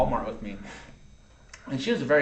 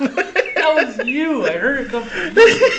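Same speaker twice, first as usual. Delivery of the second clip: laughing through quote; 10 dB louder, slower than usual. Retold or imitated. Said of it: "And she".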